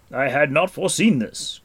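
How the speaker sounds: ordinary delivery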